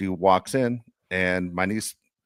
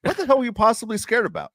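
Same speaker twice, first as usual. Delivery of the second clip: high-pitched incredulous tirade, replayed; reenacting chewing someone out in disbelief; retold rant; 7 semitones higher than usual. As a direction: relive laying into her, pitched up. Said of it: "So I just laid into her".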